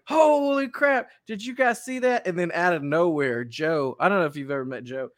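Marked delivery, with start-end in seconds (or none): 0.0-2.3 excited vlogger. Retold or imitated. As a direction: hype it up for the camera. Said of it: "he's like".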